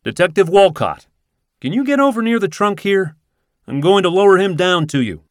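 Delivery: calling out. Acted. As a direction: shout instructions down from the branch. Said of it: "yells Silvestri".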